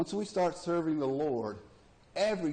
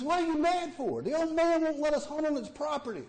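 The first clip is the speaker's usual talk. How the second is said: high voice; 13 semitones higher, 3 dB louder than usual. Acted. Voiced buddy in joke.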